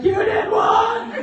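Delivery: high, loud retold disbelief; shocked shout. Husband hearing she signed up, shouting high in shock. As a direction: belt it out like a stunned husband. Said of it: "He was like".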